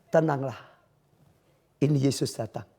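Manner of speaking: soft, low and hushed, fading to a whisper; calm reassurance. Acted. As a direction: soothe the frightened ones gently. Jesus speaks to the listeners. (suara lembut menenangkan)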